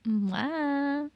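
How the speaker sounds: hammed-up Cali-girl baby whine; Cali girl baby cry. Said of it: "a Cali girl and she'd be like".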